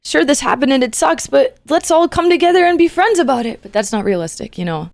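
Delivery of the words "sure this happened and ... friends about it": mock cheery hero voice, higher and louder; bright-eyed cartoon hero cheer, hammed up; Disney protagonist. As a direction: pretend the naive happy ending. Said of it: "That's the Disney protagonist in me".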